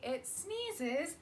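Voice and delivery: plain reading voice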